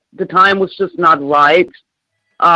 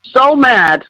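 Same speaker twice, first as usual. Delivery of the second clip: pitched-up, emphatic anger; real anger; 5 semitones higher than usual. Real, not acted. Caller telling the host how mad she is, angry. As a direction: lean hard into the anger. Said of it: "I am fucking mad".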